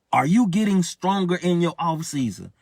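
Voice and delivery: ordinary delivery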